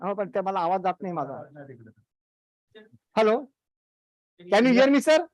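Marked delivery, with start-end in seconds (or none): 3.1-5.3 louder call to check the line. Real, not acted. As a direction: raise the voice to be heard. Calling out after mic failed.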